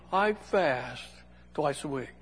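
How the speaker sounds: mock-pious quiet boast; self-satisfied prayer tone. Pharisee tells God of his fasting, mock self-satisfied. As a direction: softly savour each phrase, with pauses. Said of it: "he said that in that tone to God".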